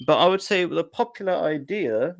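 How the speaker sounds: stuffy voice from blowing nose; talking right after blowing nose. Real, not acted. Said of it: "sorry about me blowing my nose".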